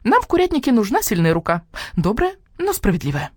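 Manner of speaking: high, eager hen voice; the hen boasting about her home; proud hen. Acted. Hen praises Nina. (гордая курица, голос выше)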